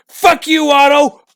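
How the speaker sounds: mock enraged shout at Otto; angry yell. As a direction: bark it out like you finally snapped. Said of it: "should have literally been pissed".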